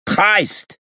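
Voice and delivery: high-pitched exasperated oath; muttered curse. A narrator's reading. Freed gangster cursing his plight, high exasperated voice. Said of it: "he muttered".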